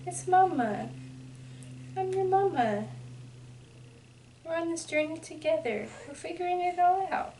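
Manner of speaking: tender mother coo. Real, not acted. Mom cooing to baby.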